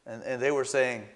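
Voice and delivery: ordinary talk, nothing marked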